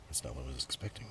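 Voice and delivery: ordinary talk, nothing marked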